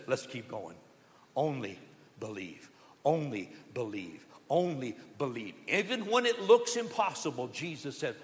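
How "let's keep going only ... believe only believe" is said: soft, low, calm encouragement; quietly steady a grieving father; gentle reassurance. Retold or imitated. Jesus reassuring Jairus about his daughter, soft and calm. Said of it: "But Jesus says".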